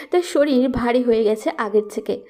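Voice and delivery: ordinary delivery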